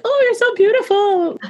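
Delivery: high, cooing mock praise; sugary fawning, hammed-up; gushing flattery. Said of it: "I don't want someone to be like".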